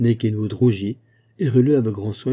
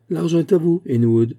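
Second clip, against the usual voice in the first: dry laugh; 7 semitones higher, 5 dB louder than usual. A narrator's reading. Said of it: "dit-il avec un rire sec".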